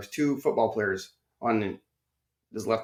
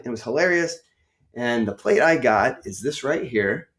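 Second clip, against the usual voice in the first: ordinary delivery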